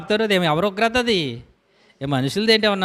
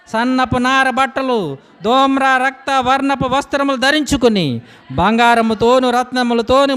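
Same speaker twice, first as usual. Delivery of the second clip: raised, booming scripture reading; preaching at full force; 4 semitones higher, 4 dB louder than usual. Acted. Preacher to congregation reading judgement, booming preaching. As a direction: proclaim the doom of the city.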